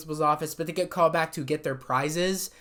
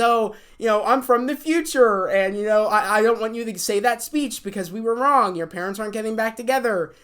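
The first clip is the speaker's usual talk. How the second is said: higher, louder mock announcement; raised-voice stage plea; 5 semitones higher, 5 dB louder than usual. Carly as Romeo warning Millicent off her speech, mock loud plea. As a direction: retell it as an urgent call from the stage.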